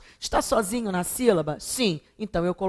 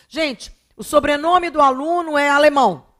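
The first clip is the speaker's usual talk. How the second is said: loud, higher-pitched teacherly protest; incredulous, raised-voice correction; exasperated; 7 semitones higher, 6 dB louder than usual. Real, not acted. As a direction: spell out the obvious to students, loudly exasperated. Teacher exasperated at students' mistake.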